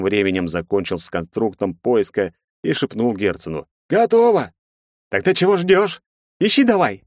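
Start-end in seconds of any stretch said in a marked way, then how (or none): 3.9-4.5 high, loud character voice; henchman reporting. A narrator's reading. Fat henchman tells Herzen the spell is done, high loud report. 5.1-7.0 high, loud, impatient command; irritated. A narrator's reading. Herzen orders the henchman to start searching, irritated. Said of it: "раздражённо произнёс тот".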